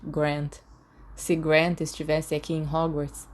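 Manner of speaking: plain reading voice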